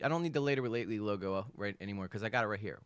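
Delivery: ordinary talk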